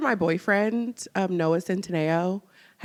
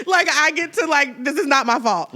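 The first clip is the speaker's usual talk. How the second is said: mock relief; 5 semitones higher, 4 dB louder than usual. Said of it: "Sunny looked so relieved".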